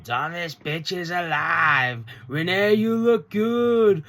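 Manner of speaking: high, drawn-out wrestling promo voice; Tekla. Retold or imitated. Fan mimics a wrestler's promo, drawn-out crowing impression. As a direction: crow it slowly and high, imitating a cocky champion. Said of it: "we actually get Tekla cutting a little promo".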